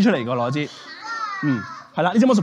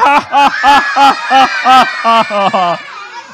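high, loud, drawn-out "ha ha ha"; hammed-up laugh pulsing on and on; big showman laugh; 5 semitones higher, 7 dB louder than usual. Magician laughing over his rabbit joke, hammed-up.

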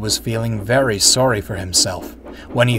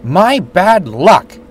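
slow, high, loud grumble; hammed-up fuming engine; 6 semitones higher, 6 dB louder, much slower than usual. A narrator's reading. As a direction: spit it out in a sulk. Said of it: "fumed James".

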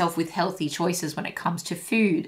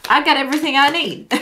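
high, quick sassy boast; sassy self-assurance; 6 semitones higher, 4 dB louder, faster than usual. Acted. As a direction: toss it out like a playful diva. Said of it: "That's that kind of energy".

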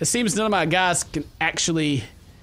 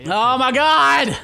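slow, loud, higher outburst; exasperated shout; 7 semitones higher, 6 dB louder, much slower than usual. Real, not acted. Player sent to get another Titan, exasperated shout. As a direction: draw it out loudly, fed up.

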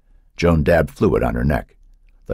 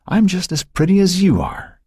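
put-on very high, childish insistence; boasting little girl; 13 semitones higher than usual. A narrator's reading. Young girl boasts to her sister.

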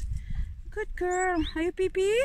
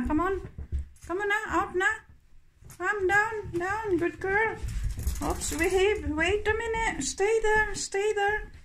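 pitched up, coaxing the dog to calm down and wait; sing-song high voice for an eager puppy; high coaxing dog talk; 6 semitones higher than usual. Real, not acted. Owner coaxing her excited dog before the walk, high coaxing voice.

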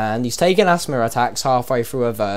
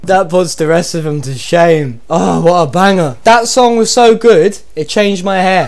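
hammed-up sarcastic praise, slow and loud; mock rave; 5 semitones higher, 6 dB louder than usual. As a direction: gush over it like it is genius, tongue in cheek. Gushing over a bad rap.

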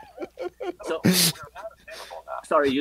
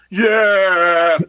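loud, high mock soul yell; bad [name] yeah; 5 semitones higher, 14 dB louder than usual. [name] at the tribute session, a terrible loud [name] yeah. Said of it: "the worst [name] sounding yeahs".

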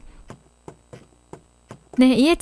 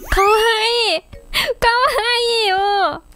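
excited squeal; 9 semitones higher than usual. Real, not acted. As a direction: gush over something adorable. Squealing over cute villager. (かわいさに叫ぶ)

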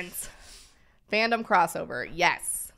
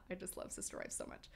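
whisper; 13 dB quieter than usual. Real, not acted. Gushing aside after laughing.

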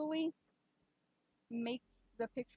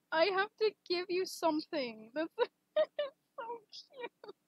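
delighted gushing; 6 semitones higher, 4 dB louder than usual. Real, not acted. Gushing over cute art.

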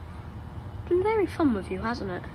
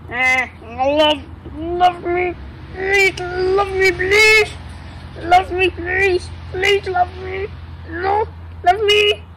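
high pleading whiny voice; love me voice; 7 semitones higher, slower than usual. Pretend. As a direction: beg for love, high and drawn out. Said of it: "that's the love me voice".

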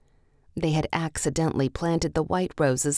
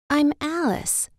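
put-on much higher young girl's voice; Alice's voice; 10 semitones higher than usual. A narrator's reading. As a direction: answer brightly as a little girl. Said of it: "she said".

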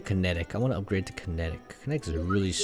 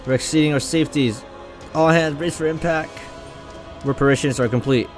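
loud, raised-pitch combat callouts; urgent battle dialogue; 5 semitones higher, 9 dB louder than usual. Acted. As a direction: read the crew's orders loudly under fire. Reads battle dialogue aloud.

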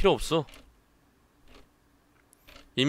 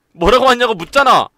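furious demand; 9 semitones higher, 13 dB louder than usual. Acted. Demands answer.

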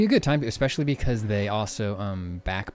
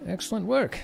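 higher, cheery mayor voice; 8 semitones higher than usual. Acted. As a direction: play a pleased, chipper town mayor. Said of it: "The mayor's standing outside".

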